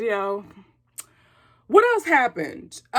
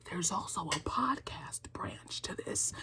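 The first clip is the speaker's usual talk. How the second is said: low, soft secret whisper; 7 semitones lower, 15 dB quieter than usual. Acted. Secret-telling aside.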